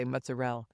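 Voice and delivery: "mozzarella" cut to mutza-RELL; Staten Island pronunciation. Shown as a demonstration. Host shows how her hometown says it, "mozzarella" as mutza-RELL. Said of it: "so they say mozzarella".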